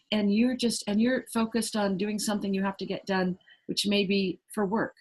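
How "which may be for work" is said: normal talking voice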